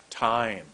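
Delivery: Time with a long falling vowel. Shown as a demonstration. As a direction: draw out the vowel in Time, tongue tip starting behind the teeth. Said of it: "My mouth is almost closed".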